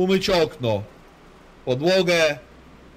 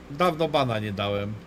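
usual speaking voice

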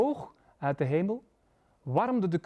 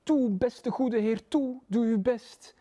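high, slow begging voice; wheedling high plea; pleading little creature; 6 semitones higher, slower than usual. A narrator's reading. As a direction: plead with drawn-out words, pitched up. Little beast begs the Lord.

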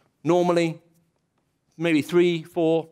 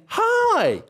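cheery greeting pitched way up; bright high hi. Shown as a demonstration. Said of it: "There's a difference between me saying".

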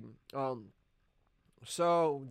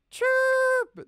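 shrill high kettle whistle imitation; 12 dB louder than usual. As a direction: screech like a boiling kettle.

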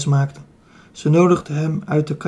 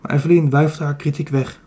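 ordinary delivery, nothing marked

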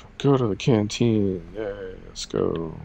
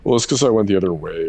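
ordinary talk, nothing marked

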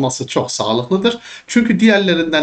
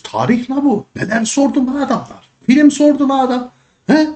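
much higher, incredulous rant at the exam; exasperated outburst; 11 semitones higher than usual. Real, not acted. Teacher ranting to students about exam questions, high and incredulous. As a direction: throw up your hands over the questions.

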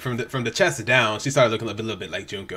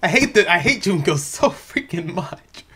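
higher, drawn-out frustrated rant; exasperated venting; 6 semitones higher, slower than usual. Real, not acted. Viewer venting about Junko, exasperated. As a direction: let the hatred spill out, exasperated.

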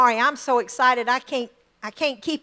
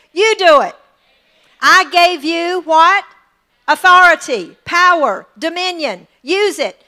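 full-force preaching; 5 semitones higher, 7 dB louder than usual. Acted. Pastor urging the congregation, a loud, high proclamation. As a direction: hammer each word of authority.

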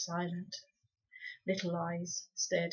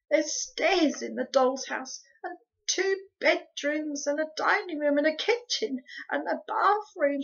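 plead, lingering over each item; husky pleading; 8 semitones higher, 6 dB louder than usual. A narrator's reading. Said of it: "pleaded Homily in a husky whisper".

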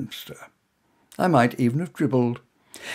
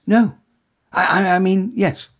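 flustered stammer; 6 semitones higher, 8 dB louder than usual. Acted. Tongue-tied hero stammers.

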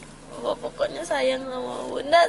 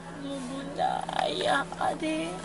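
teary; 10 dB quieter than usual. Real, not acted. Guest crying while saying goodbye.

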